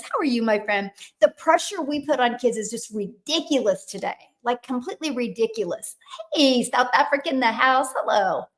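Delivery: usual speaking voice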